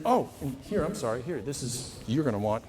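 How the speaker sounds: ordinary talk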